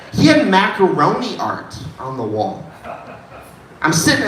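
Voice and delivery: ordinary talk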